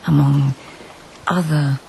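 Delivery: story narration. A narrator's reading. Narrator recounting the search through forest, storytelling voice. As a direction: paint the journey step by step.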